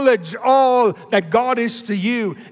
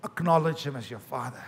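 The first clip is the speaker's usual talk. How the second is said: soft gentle quote; 5 semitones lower, 8 dB quieter than usual. Retold or imitated. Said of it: "He said".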